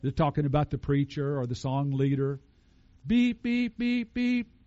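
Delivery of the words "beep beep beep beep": high-pitched electronic beep beep; imitated beeper. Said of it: "The old creature beeper went off".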